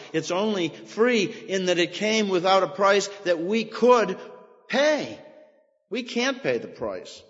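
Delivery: normal talking voice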